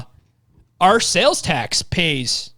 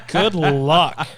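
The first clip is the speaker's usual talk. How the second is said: laughing. Real, not acted. Laughs at the challenge.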